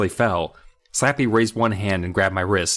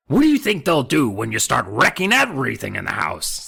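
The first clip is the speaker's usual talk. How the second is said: demanding dummy voice; 5 semitones higher than usual. Acted. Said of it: "Slappy demanded".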